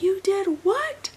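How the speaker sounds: high, slow, stunned "you did what"; appalled retold surprise; shocked disbelief. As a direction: let the pitch shoot up in disbelief. Shocked reaction to news.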